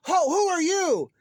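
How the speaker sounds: high, loud, slow fake startled cry; put-on shocked gasp of a question; mock surprise. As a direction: play a hammed-up surprised discovery. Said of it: "Nick walks in and".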